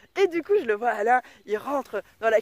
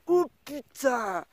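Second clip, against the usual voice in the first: mock dismayed gasp; 3 semitones higher, much slower than usual. Son sees his mother's face.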